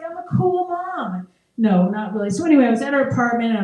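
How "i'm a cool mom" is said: mock cool mom.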